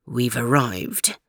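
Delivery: low, soft and slow; a quiet, ominous arrival in a Scottish accent; hushed announcement. Acted. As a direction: announce it under the breath. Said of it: "announced Jock".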